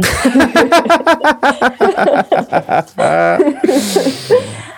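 a high bright burst of laughter. Real, not acted. Laughing at admitting anger all the time, high laugh.

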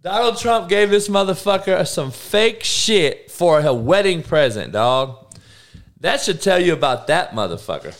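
can't believe the fake present; outraged disbelief. Real, not acted.

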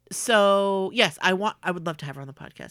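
normal talking voice

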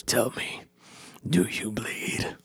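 quiet, breathy menacing growl; imitating Batman. Batman confronting Superman from the Batmobile, menacing whisper. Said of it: "Batman's in the ... and he goes".

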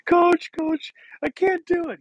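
put-on high-pitched wailing kid; mock crying child. Crying boy to his coach, high mock wail. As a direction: imitate a twelve-year-old pleading through tears. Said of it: "I just broke down crying".

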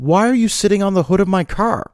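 high-pitched acted disbelief; incredulous demand. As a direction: replay a baffled, indignant question. Questions stranger on his car.

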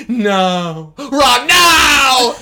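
rising loud whiny cry; Hermione impression. Co-host imitates Hermione scolding Ron, rising and loud. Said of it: "she's like".

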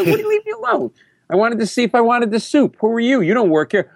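high-pitched mock outrage; indignant retort. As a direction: push back at a nosy stranger. Acted quarrel, snapping back at accuser.

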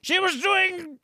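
high-pitched mock outrage; lightly hammed-up prudish scandal, pitched high; scandalised character voice. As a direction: play scandalised gossip. Riffing as a scandalised fancy character.

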